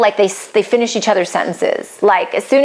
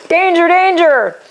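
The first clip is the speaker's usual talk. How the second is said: mock alarm; 8 semitones higher than usual. Coach jokingly warns listeners about a red score, mock alarm. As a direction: call out a playful high warning.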